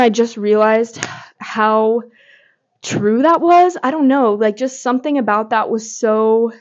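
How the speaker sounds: normal talking voice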